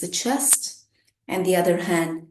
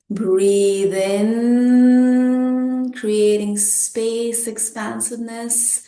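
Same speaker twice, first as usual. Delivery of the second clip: very slow, louder and higher, held long; drawn-out breathing cue; 3 semitones higher, 6 dB louder, slower than usual. Shown as a demonstration. Teacher paces the group's inhale, drawn-out breathing cue. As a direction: stretch "breathe in" over the whole inhale, swelling on "in".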